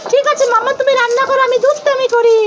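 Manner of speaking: put-on high, mischievous little-kid voice; cheeky child figure. Toy child announcing mischief, in a high cheeky voice. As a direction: squeak it like a naughty small child.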